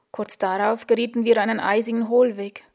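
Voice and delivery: plain reading voice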